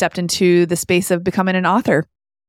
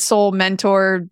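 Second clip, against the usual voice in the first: ordinary talk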